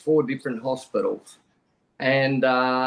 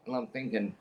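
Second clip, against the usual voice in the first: ordinary delivery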